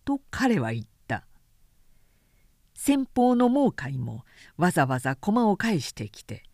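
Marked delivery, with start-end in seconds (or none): none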